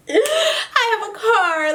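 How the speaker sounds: overwhelmed joy spilling into a teary laugh; half laugh, half cry, slowly; laughing through tears. Real, not acted. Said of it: "Oh, I'm getting emotional".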